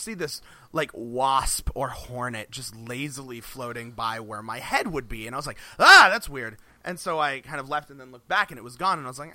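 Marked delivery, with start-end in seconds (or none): 5.8-6.6 acting out a loud startled "ah"; mild mock fright, louder and higher. Telling a friend about a wasp sighting, startled.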